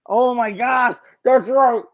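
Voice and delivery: loud, high-pitched burst of real surprise; astonished recognition. Real, not acted. Player hearing the trivia answer they missed, astonished. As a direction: cry out as the forgotten answer comes back.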